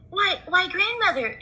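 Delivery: put-on wondering girl; high child voice; girl's voice. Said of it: "she said".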